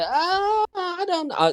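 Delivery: very high, drawn-out mock hesitation; hesitant girl. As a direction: imitate a girl stalling in a high-pitched voice. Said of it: "And then if they say".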